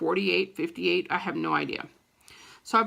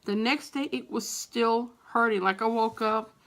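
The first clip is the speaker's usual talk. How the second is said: ordinary talk, nothing marked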